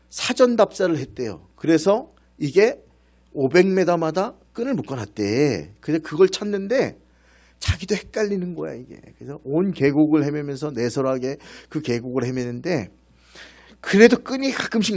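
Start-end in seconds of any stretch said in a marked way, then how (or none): none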